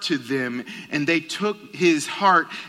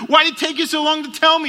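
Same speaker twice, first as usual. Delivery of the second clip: much higher, louder, fast incredulous question; can't believe it took so long; surprised question; 14 semitones higher, 7 dB louder, faster than usual. Retold or imitated. Father asks his son why he waited so long, astonished.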